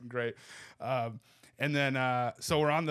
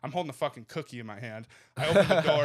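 ordinary talk, nothing marked

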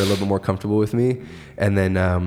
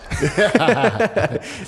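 big laugh; 12 semitones higher, 3 dB louder than usual. Real, not acted. Friend calls him a savage.

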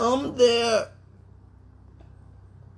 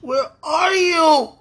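slow, loud anguished cry; wailing out for him; crying shout; 4 semitones higher, 6 dB louder, slower than usual. Real, not acted. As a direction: call out through real sobs. Crying out for missing loved one.

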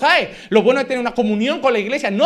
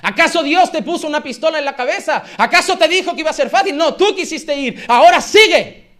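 mock stern wife; 6 semitones higher, 7 dB louder than usual. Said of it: "¿Saben qué me dice mi mujer?".

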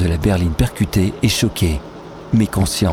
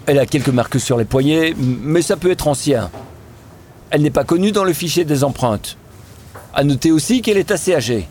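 a lightly voiced officer, matter-of-fact; gendarme's report; 6 semitones higher than usual. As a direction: report the facts to a superior. (rapport de gendarme, légèrement interprété)

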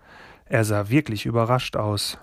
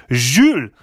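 louder, sharp French exclamation; loud interruption; 3 semitones higher, 8 dB louder than usual. A narrator's reading. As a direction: cut him off loudly. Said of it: "fuhr Grandmère laut dazwischen". (laut dazwischenfahrend)

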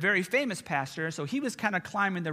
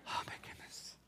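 whisper; 18 dB quieter than usual. Real, not acted. Aside after story.

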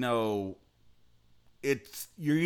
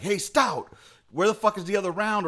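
mock sergeant yell at a private, pitched up; chief yelling; 6 semitones higher, 3 dB louder than usual. Section chief yelling at his private about the round, mock yell. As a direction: bark it like a section chief chewing out a soldier. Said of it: "the chief starts yelling at this one kid".

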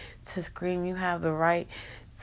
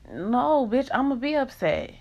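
higher, sharp comeback; defiant snap; 6 semitones higher than usual. Real, not acted. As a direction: push back hard, fed up. Snapping back at coworkers.

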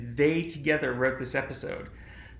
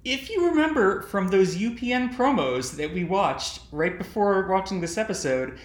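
ordinary talk

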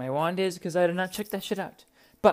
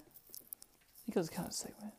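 whisper; 5 semitones higher, 14 dB quieter, slower than usual. Real, not acted. Unsure muttering while editing.

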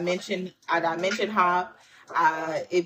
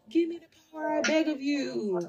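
Chucky; 8 semitones higher, 4 dB quieter than usual. Acted. Reader jokingly quotes a horror doll begging for power, high creepy chant. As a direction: put on an eerie high voice, pleading quietly. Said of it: "why did Chucky come to my mind?".

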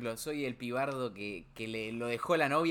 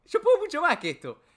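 much higher and louder, taunting; defiant shout; 11 semitones higher, 9 dB louder than usual. Retold or imitated. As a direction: challenge the universe to hit harder. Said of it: "sentimiento de decir". (grito desafiante, mucho más agudo y fuerte)

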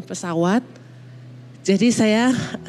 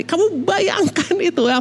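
high, pleading emphasis; exasperated appeal; 8 semitones higher than usual. Retold or imitated. Mother urging husband to imagine so few days, exasperated appeal. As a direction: press the point in a raised pitch. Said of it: "saya bilang".

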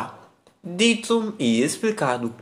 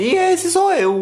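squeaky high voice; 5 dB louder, slower than usual. Pretend. Sudden high self-introduction.

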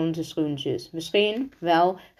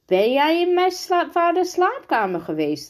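high, louder shocked question; verontwaardigde uitroep; 11 semitones higher, 3 dB louder than usual. A narrator's reading. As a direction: cry it out in disbelief, pitched way up. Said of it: "riep Angelino". (hoog en luid uitgeroepen)

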